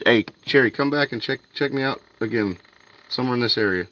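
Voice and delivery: ordinary delivery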